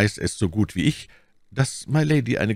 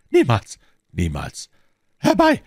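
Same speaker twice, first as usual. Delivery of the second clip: shouted refusal; 4 semitones higher, 5 dB louder than usual. A narrator's reading. Buckingham refusing Felton and calling servants, shouting. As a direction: cry out in defiance and summon help. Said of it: "schrie der Herzog".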